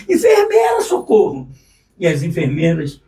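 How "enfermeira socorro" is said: retold cry for help. Waking in a pool of blood, yelling for the nurse in panic. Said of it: "comecei a gritar".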